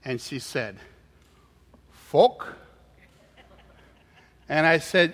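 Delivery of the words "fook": put-on puzzled Chinese-accented query, dropping the R in "Fork"; non-native Chinese accent, "Fork" as a rising "fuk"; waitress with limited English. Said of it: "somebody speaks English".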